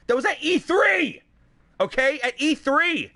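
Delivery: high, exasperated yell; angry shout. Real, not acted. Streamer correcting viewers on the announcement, angry shout. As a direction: drive the correction home, really shouting.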